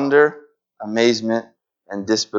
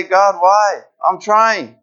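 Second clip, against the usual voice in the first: high, strained plea; pleading; 6 semitones higher, much slower than usual. Retold or imitated. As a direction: ask God why, worn out. Voicing a struggling spouse.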